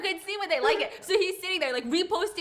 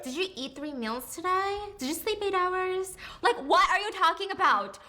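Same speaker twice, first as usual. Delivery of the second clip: mock-caring questions pitched up, slowing down; mock the clingy guy's fussing, higher and slower; 5 semitones higher than usual. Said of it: "he comes crawling back like".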